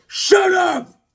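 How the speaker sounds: high, loud shout; kid's shout. Retold or imitated. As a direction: yell at the class to shut up. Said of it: "I was like".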